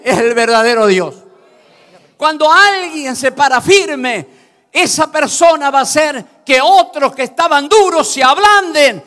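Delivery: loud, high fervent preaching; preaching at full force. Acted. Preacher urging the congregation to stand firm, loud and fervent. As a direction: thunder the message at the congregation.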